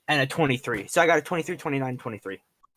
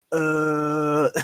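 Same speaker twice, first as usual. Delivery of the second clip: dumb zombie moan; zombie groan; 2 semitones higher, 3 dB louder than usual. Retold or imitated. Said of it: "They go".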